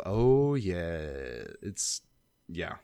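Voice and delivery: low, drawn-out gloating; mock relish. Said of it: "does have the look on of".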